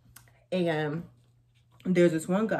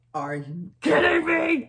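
much higher, louder, slow despairing wail; exasperated outcry; 11 semitones higher, 8 dB louder, slower than usual. Retold or imitated. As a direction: cry out in disbelief at bad luck. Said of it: "I was like".

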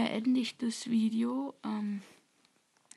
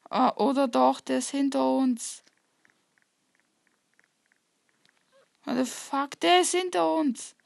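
being chased; 3 semitones higher, 6 dB louder than usual. Real, not acted. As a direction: yell in panic while fleeing. Chased by enemy.

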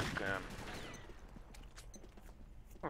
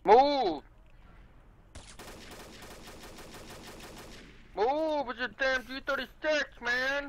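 very high-pitched urgent yelp; urgent calling out; slower than usual. Real, not acted. Player orders teammate to move, high urgent yelp.

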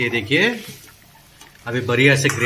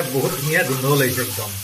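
ordinary delivery

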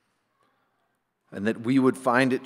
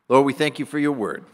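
usual speaking voice

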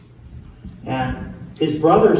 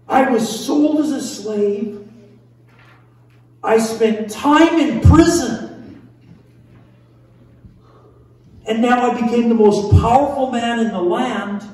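Joseph's thoughts; 5 semitones higher, 6 dB louder than usual. Acted. Voices Joseph's inner thoughts.

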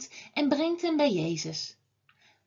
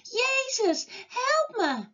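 mock shouting; 9 semitones higher, slower than usual. A narrator's reading. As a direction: call for help in a much higher pitch. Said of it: "nog weer veel harder schreeuwen". (hoge, ingehouden hulpkreet)

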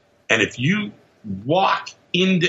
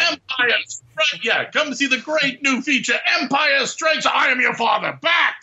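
shouting announcer; 12 semitones higher than usual. Pretend. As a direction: yell the spoiler like an ad. Mock spoiler announcer shouting.

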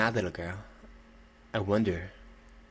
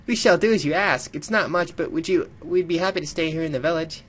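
higher, louder polite villager; formal character voice; 8 semitones higher, 5 dB louder than usual. Acted. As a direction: read it as a courteous traveler. Voicing a game character.

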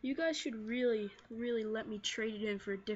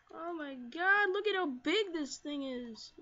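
loud, raised astonished exclamation; amazed; 3 semitones higher, 6 dB louder than usual. Real, not acted. Player marvels at a huge Pokémon, amazed.

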